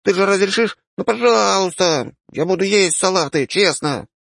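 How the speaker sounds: put-on high, pleading kid voice; begging child. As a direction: wheedle mom for cola. Child begs mother.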